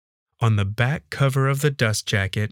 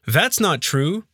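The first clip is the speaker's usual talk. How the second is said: higher-pitched, sudden protest; blurted denial; 7 semitones higher, slower than usual. A narrator's reading. Man told he writes books, blurting in disbelief. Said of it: "I blurted".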